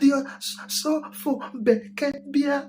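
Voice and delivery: high, slow sing-song intoning; pastor's words. Retold or imitated. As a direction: stretch it out like a preacher. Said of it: "No more, so, sir".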